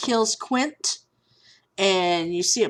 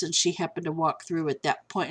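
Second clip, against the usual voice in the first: ordinary talk, nothing marked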